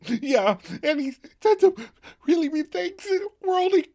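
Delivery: laughing while imitating a teary wail; imitating Rachel crying to her friends; mock sobbing. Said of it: "Rachel gasps between sobs".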